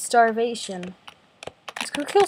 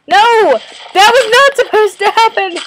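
much higher and louder shouting of No and not; real outburst; 10 semitones higher, 13 dB louder than usual. Real, not acted. Shouting at the game after a mistake, loud outburst. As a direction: yell in frustration.

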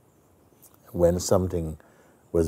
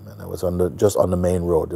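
ordinary talk

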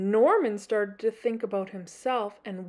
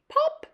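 very high popping sound effect; high cartoon pop. Sound effect of tree appearing.

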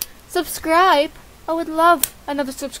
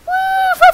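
very high, loud "woo"; high whoop; 8 dB louder than usual. Acted. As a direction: whoop at a falsetto pitch. Whoops while flipping in game.

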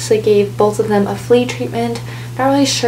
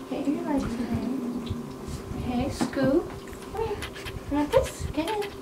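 soft coaxing; 3 semitones higher, 12 dB quieter than usual. Real, not acted. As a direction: coax a shy kitten in softly. Coaxing kittens into the carrier.

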